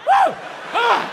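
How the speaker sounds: high whooping call to the crowd; excited whoop. Real, not acted. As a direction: hype the room up.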